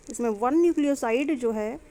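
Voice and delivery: ordinary delivery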